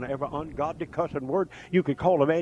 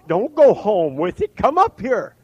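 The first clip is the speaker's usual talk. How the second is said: loud, rising preacher's summons; raised, pleading invitation; altar call; 7 semitones higher, 8 dB louder than usual. Real, not acted. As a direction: call them forward urgently from the pulpit. Calls people up to the altar.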